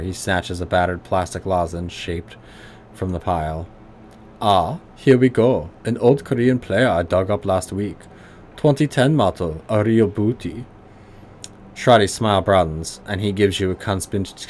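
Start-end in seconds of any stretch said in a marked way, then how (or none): 4.4-10.6 put-on gruff German accent, pitched higher. Old tinkerer greeting the player in a higher gruff German accent. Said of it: "high German voice".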